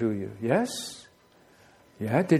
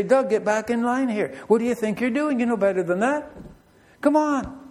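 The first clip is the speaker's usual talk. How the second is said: God scolding; 7 semitones higher than usual. Retold or imitated. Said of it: "gives me a rap on the knuckles and say".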